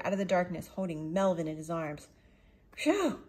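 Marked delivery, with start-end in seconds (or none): 2.8-3.2 exaggerated phew. Relief after the scary moment.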